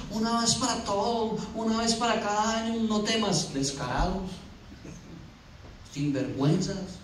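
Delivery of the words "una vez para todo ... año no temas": higher, softer singsong mimicry; mock it on a raised, even pitch; mocking quote. Preacher mimicking a once-a-year "no temas" claim, mocking.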